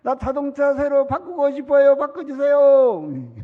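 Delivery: wheedling cry to God; loud pleading prayer. Pretend. Pastor acts out bold prayer.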